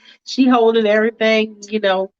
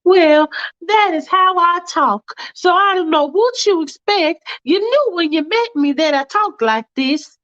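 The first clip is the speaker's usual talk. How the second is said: Faith indignant; 10 semitones higher than usual. Acted. Faith answering Michael's complaint, indignant and high. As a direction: defend how she talks, huffy. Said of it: "Stop saying that silly".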